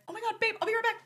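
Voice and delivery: high, excited rush; excited squeal. Retold or imitated. Fan rushing off to meet her hero, excited and high. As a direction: tell babe you'll be right back, thrilled.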